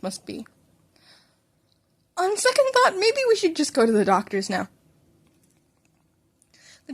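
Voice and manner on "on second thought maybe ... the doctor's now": high, strained with alarm; alarmed mother. A narrator's reading. Mother to son after hearing his jaw crack, alarmed. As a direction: voice jumps up in shock, then hurries.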